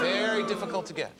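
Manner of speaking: ordinary talk, nothing marked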